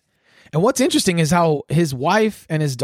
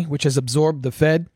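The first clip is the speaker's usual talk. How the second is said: normal talking voice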